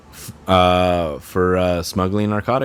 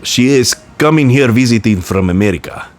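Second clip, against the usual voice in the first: put-on broken-English Russian accent, dragged out at the end; hammed-up Russian official fumbling for American law; mock Russian officials; 2 semitones higher than usual. Russian officials wondering about American law, mock Russian accent. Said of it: "so they're not gonna be like".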